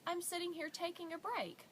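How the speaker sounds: high-pitched imitation of a woman; lifted voice explaining to a small child; friendly woman. Woman on her break answering a three-year-old, high voice.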